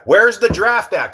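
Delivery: high, drawn-out call; playful demand. Pretend. Streamer asking Cousin for the draft, playful demand. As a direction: stretch it out, calling for the draft.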